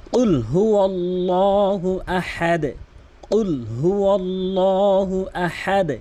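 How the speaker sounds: very slow melodic tilawat; slow Quran recitation. Shown as a demonstration. Teacher modelling correct recitation, slow chant. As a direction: draw each word out in chant. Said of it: "এভাবে তেলাওয়াত করবেন".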